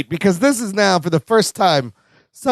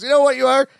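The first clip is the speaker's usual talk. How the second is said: high, sneering jeer; playful imitation of Larry jeering; mock taunt; 8 semitones higher than usual. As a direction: tease him, pitched up. Larry taunting Rob Bob.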